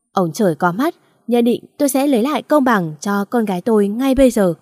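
plain reading voice

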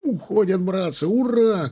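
high victorious cheering shout; triumphant shout. Acted. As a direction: cheer as the enemy flees. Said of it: "крикнул Фрол".

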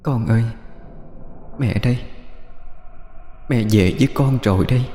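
soft ghostly voice from far away; faint distant echo. Acted. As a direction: call softly as if from another world. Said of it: "nói giọng văng vẳng, âm vang như từ cõi xa xăm".

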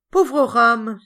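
crying out. A narrator's reading. Ram laments his headless, bodiless portrait, crying out. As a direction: cry it out, high and loud. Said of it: "s'écria-t-il".

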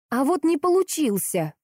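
slow, high-pitched indignant retort. A narrator's reading. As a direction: throw it back in exasperation. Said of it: "возмущалась Лиза". (возмущённо, растягивая)

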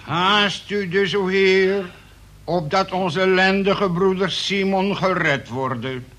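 preaching at full force; fiery prayer. Acted. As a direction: call down wrath like a preacher. Second prayer after tea.

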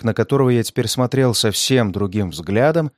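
usual speaking voice

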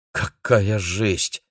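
slow, drawn-out amazement; breathed-out awe. A narrator's reading. Максим reacts to the legend of hopping corpses, exhaling in awe. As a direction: let it out slowly, mildly stunned. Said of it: "выдохнул Максим".

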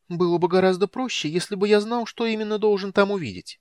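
very high, squeaky kender voice; meek squeak. A narrator's reading. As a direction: squeak it meekly, high and loud. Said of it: "смиренно пискнул Тассельхоф". (смиренно, писклявым голосом)

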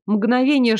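ordinary delivery

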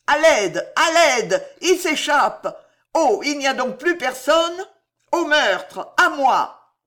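high, loud cries for help; frantic vociferating. A narrator's reading. Ruggieri running madly as the prisoners escape, shouting frantically. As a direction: shout desperately for someone to come. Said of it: "vociférant des malédictions".

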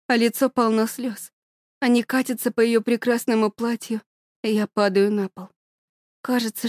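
ordinary delivery, nothing marked

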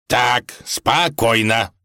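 slow, firm command from the tsar; mildly stern, drawn-out calming order. A narrator's reading. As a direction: bring them to order, measured pace. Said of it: "строго сказал ему царь".

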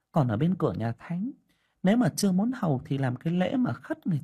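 a village sorceress fretting over what she sees; fortune teller voice. Acted.

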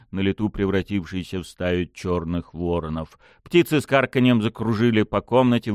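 ordinary delivery, nothing marked

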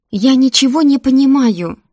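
loud, high-pitched exasperation; frustrated outburst. A narrator's reading. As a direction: burst out in frustration. Heroine protests in frustration.

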